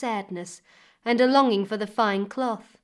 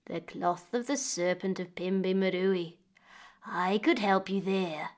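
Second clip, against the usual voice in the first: put-on sly old woman. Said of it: "The old woman gave a crooked smile".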